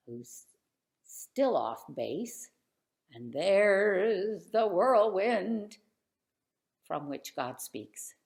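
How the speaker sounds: ordinary delivery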